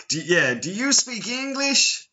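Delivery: high-pitched mock American tourist voice. British expat imitating tourists on the Spanish metro, mock American accent. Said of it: "they're, they're like".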